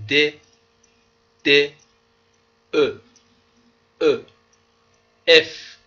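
each French letter read out loud and clear, with a pause after each; French alphabet drill. Shown as a demonstration. Teacher drills the French alphabet letter by letter for students. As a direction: spell out the French letters slowly for the class to repeat.

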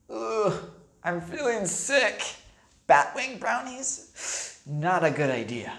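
sick at the thought; disgusted boy. Acted. Arthur disgusted by snacks.

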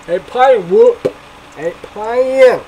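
loud, high hype shout; hyped shout. Real, not acted. Fired up about spicy burgers, loud hyped shout.

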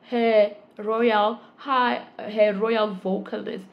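slow, loud, grand announcement of a royal title; mock royal title. Vlogger crowns singer [name] with a hammed-up royal title. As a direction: proclaim her like royalty, drawn out.

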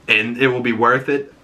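ordinary delivery

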